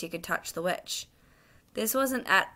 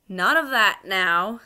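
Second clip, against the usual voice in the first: slow, louder policeman's rebuke; stern; 8 dB louder, slower than usual. Acted. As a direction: lay down the law sternly. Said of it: "said the policeman sternly".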